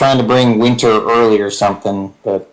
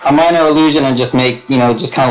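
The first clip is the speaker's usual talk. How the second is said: usual speaking voice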